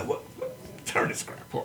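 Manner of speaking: whispered, high mock Brit; a whispered English put-down; mock English viewer. Imagining English viewers, whispered mock British complaint. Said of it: "in England and going".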